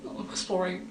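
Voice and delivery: ordinary talk, nothing marked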